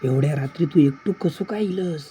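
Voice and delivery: astonished. Acted. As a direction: ask in growing amazement, pitch climbing. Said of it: "आश्चर्यचकित होऊन म्हणाले". (आश्चर्यचकित होऊन विचारणे)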